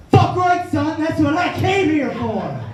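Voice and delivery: eager battle cry, ready to duel; gleeful shout. Retold or imitated. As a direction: shout it like you've waited all day for this fight. Accepting a duel, retold.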